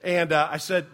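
ordinary delivery

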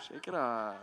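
put-on brushing off the kid's jab, chuckling; laughing it off. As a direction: shake it off through a laugh. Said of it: "And I'm like".

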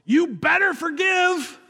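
high, drawn-out yelled warning; mock yell. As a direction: yell it high and slow, without much extra volume. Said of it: "he yells".

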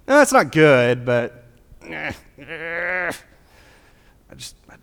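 a hint of a sheepish sinner making excuses; lightly voiced excuse-maker, hemming; mock sinner. Preacher mimicking a sinner's excuse, lightly voiced.

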